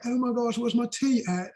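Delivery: put-on a high, posh-leaning London accent on the tea line; imitating English accent. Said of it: "she'd be like".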